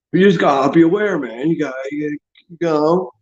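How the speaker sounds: usual speaking voice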